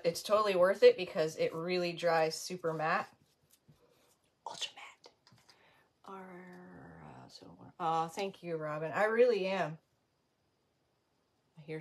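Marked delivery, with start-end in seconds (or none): 4.5-7.5 breathy whisper of the name. Real, not acted. Murmuring the product name to viewers, a soft whisper. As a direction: hush it like a secret.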